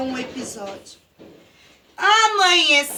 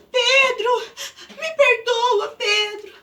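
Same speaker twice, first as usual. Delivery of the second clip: high, tearful plea for forgiveness; crying apology; 4 semitones higher than usual. Acted. Léo apologizes to Pedro, crying. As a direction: sob out an apology.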